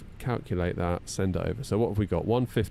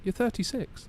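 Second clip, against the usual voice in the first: high, insistent mock wife voice; wife insisting; 14 semitones higher than usual. Wife correcting his age, voiced high and firm. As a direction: jump up high to play the wife. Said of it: "She's like".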